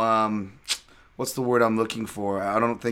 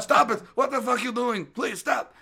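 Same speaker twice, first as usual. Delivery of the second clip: much higher, squealing plea to stop; panicked pleading; 10 semitones higher than usual. Retold or imitated. The tackled coach pleads with the fighter to stop, high and panicked. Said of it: "And he's like".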